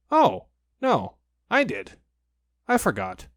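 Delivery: mild oops, then remembers; Pooh realising. Acted.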